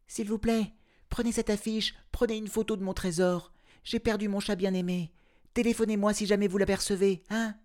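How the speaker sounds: high, fast pleading woman's voice; anxious owner calling out to the crowd; woman crying out. A narrator's reading. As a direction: call to the crowd for her lost cat. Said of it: "Je l'entends crier à la foule".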